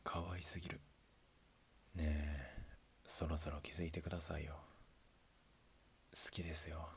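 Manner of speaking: put-on soft whispered confession to a sleeping girl; tender whisper. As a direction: murmur it so you don't wake her. (寝顔に向けた優しいささやき)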